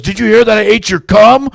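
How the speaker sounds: high, loud, horrified demand; panicked shouted question. Retold or imitated. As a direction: shout it like a mortified friend. Retold panicked confrontation in car.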